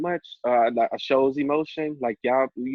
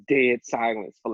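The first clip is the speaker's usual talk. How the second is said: ordinary talk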